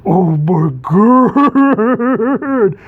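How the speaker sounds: drawn-out, high, loud oh my God; shocked heroes gasping; mock shock. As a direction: stretch it out in hammed-up disbelief.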